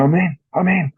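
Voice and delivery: imitating his mate, pitched high; excited shout of a bite. Mate at his rods in the pouring rain, a high thrilled cry. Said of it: "And then he's gone".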